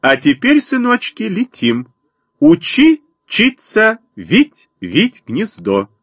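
high, sing-song bird calls; mother sparrow, bossy. A narrator's reading. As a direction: chirp the orders in high, separated bursts. Sparrow voiced by narrator. (высокий птичий говорок воробьихи)

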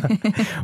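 a quick high burst of laughter; a real, brief giggle; short laugh. Real, not acted. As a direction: laugh it off at the memory. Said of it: "musste ich mal drüber lachen".

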